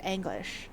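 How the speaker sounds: Anglish with an open a like ah-nglish. Shown as a demonstration. Explaining old English to viewers, says Anglish with a broad a. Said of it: "would be pronounced Anglish".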